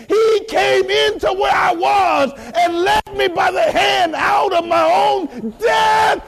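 high, ringing testimony shout; full-force preaching. Real, not acted. Preacher testifies he was led out of death, fervent high shout. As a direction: cry it out with rising fervor.

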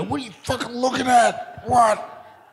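loud, high confrontational mock yell; menacing local. Host imitating Johnny Boy confronting a surfer, loud mock threat. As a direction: challenge them like a hothead local. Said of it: "and he'd just go".

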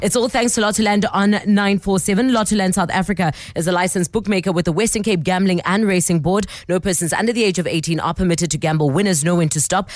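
ad read. Real, not acted. Radio host crediting sponsor and giving gambling warning, ad read. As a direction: read out the sponsor's small print smoothly.

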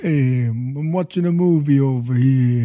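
mock New York tough guy; New York accent, forget about it. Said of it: "Give us a Leonard Maltin".

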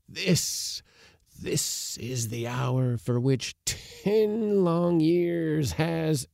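put-on high, slow, rapt old man; devout old uncle. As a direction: proclaim his hour of glory. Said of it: "upturning his face devotionally".

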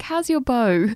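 high-pitched imitation of an English friend; English accent. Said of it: "friends of mine are English".

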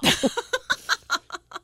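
a bright high burst of laughter. Real, not acted. Mom laughing at her kids' funny banter, with a high laugh. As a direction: laugh it out at the memory.

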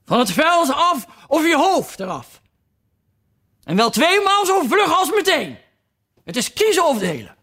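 high put-on queen, shouting threats; furious queen. A narrator's reading. Queen ordering the duchess off, a high furious voice. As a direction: stamp and bark out the ultimatum. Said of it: "op de grond stampend".

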